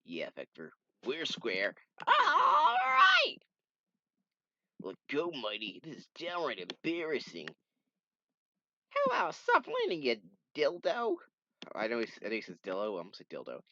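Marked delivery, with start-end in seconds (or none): 2.1-3.4 very high, loud whoop; cheering shout. Acted. Cheering after making up. 8.9-11.1 very high, loud taunt; squeaky tease; high mocking voice. Comic character voiced by reader.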